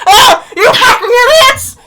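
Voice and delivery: high, loud burning man yell; screaming in pain. Acted. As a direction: shriek it while on fire. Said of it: "he starts screaming and goes".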